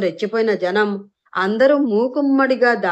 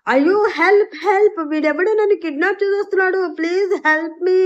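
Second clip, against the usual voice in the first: shouting for help; 8 semitones higher than usual. A narrator's reading. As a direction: scream for the crowd to hear. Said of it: "గట్టి గట్టిగా అరిచింది".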